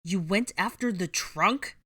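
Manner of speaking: slow, loud, incredulous anger; fuming. A narrator's reading. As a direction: fume at her recklessness. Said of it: "Draco fumed".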